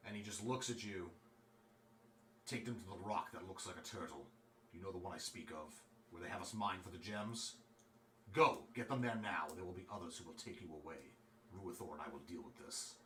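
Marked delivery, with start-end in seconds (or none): 2.5-12.9 low, soft, urgent instructions; steady them while the danger is near; grave rescuer. Acted. Man to the player, quiet urgent orders.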